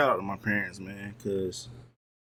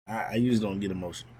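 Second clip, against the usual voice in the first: ordinary talk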